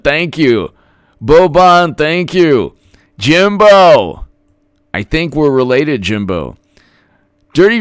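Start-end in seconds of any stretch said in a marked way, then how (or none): 1.2-2.8 loud call-out. Real, not acted. Calling out a viewer's name. 3.2-4.3 high, loud hail of a name; loud, higher cheer of the name; excited call-out. Real, not acted. Calling out a viewer's name.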